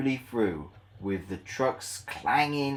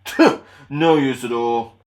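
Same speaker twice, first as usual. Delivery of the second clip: scoffing Gordon; 11 dB louder than usual. Acted.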